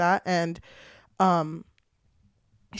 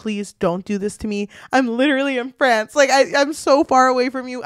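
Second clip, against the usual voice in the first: mock desperate plea, building louder and higher; retold pleading; 4 semitones higher, 6 dB louder than usual. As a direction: imitate a boyfriend begging on the phone, rising. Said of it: "crying on the phone".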